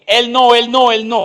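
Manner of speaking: a demon shrieking high and loud in panic; terrified cry of a cornered demon; panicked demon. Acted. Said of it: "Apenas entró ese demonio, dijo". (demonio gritando aterrado, agudo y fuerte)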